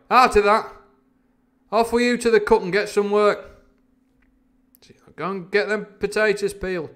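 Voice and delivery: high, loud captain's shout; crying out orders. A narrator's reading. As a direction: bellow the boy off to work, pitched high. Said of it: "he cried".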